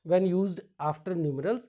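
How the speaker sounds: ordinary delivery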